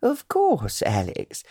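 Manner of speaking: high, delighted "Of course, Alex."; light up with eager delight; pleased surprise. A narrator's reading. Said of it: "a slight flush to her cheeks as she answered".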